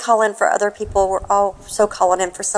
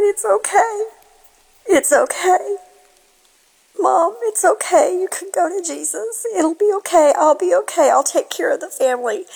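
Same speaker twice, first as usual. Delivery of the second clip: high, tearful comforting of a dying mother; a choked-up daughter's pitch-raised whispered-close farewell; tender reassurance; 8 semitones higher than usual. Retold or imitated. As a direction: soothe her gently so she can let go.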